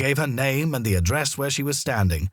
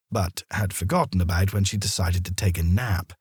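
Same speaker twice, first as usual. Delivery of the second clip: usual speaking voice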